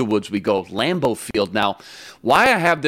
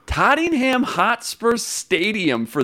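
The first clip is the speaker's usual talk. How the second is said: lightly held mock British accent, higher; bad British accent; 9 semitones higher than usual. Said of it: "That accent was horrible".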